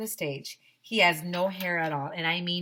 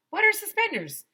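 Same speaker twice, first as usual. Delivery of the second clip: confused; 7 dB louder than usual. Acted. Confused kid narrator.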